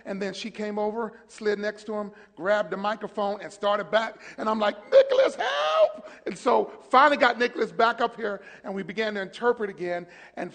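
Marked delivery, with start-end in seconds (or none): 4.9-6.1 high, slow, loud plea; desperate cry for help. Retold or imitated. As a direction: wail for rescue. Desperate call to interpreter.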